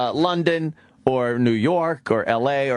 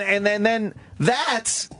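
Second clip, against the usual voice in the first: ordinary talk, nothing marked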